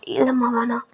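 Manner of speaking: soft whisper, drained; hushed tired refusal. Acted. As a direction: barely breathe the no. Aunt refusing coffee, grieving.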